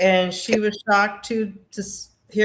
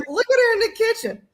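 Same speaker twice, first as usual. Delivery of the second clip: much higher, quick teasing voice; mother and grandmother teasing her in the kitchen; 11 semitones higher, faster than usual. Retold or imitated. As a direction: pitch it way up, fast and mocking. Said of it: "they're just like".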